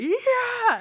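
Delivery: very high, loud yell of yeah; a fangirl shriek; screamed cheer. Retold or imitated. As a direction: scream for your favourite. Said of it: "I just, like, went insane".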